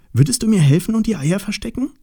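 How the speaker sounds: high, quick, urgent question; eager call. Acted. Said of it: "Osterhase rief im selben Moment".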